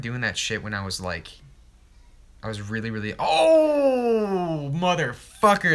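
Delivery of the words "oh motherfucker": high, loud cringe yelp; cringing outburst. Real, not acted. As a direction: yelp it out in real embarrassment. Cringing at own old video.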